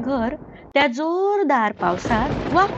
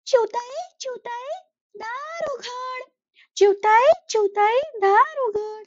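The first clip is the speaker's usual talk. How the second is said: higher pleading crow voice calling out; crow calling at the door; 11 semitones higher than usual. Acted. Cold crow begs the sparrow to open her door, pleading call.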